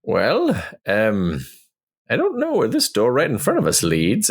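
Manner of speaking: high-pitched adventurer voice; high, put-on figure reporting back; Ornan in character. Plays Ornan answering the party.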